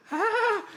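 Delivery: very high, loud startled cry; mock panicked yelp. Host acts out her overwhelmed reaction to the game, high yelp. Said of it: "It's like".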